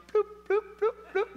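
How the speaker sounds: high, dainty donkey hoof noises; tiny popping bloops for little trotting steps; comic little clip-clop imitation.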